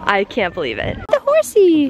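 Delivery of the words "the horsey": put-on baby talk. Cooing at the puppy.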